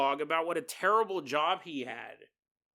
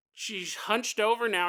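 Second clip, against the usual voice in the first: ordinary delivery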